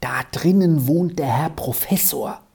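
hushed stage whisper; secretive. A narrator's reading. Squirrel warns Arthur about the professor, secretive whisper. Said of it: "sagte sie geheimnisvoll".

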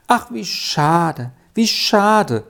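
slow, wailing lament. A narrator's reading. Sally laments Erik's fate, wailing. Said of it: "jammerte Sally".

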